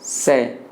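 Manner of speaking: isolated से with s made at the teeth; dental S demo. Shown as a demonstration. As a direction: model से alone, a clean dental s. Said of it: "मैं क्या उच्चारण कर रहा". (से में दंत्य स)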